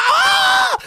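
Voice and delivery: sudden very high loud yelp; pained scream. Retold or imitated. Brother poked in the eye, sudden high loud yelp. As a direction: shriek from a finger in the eye.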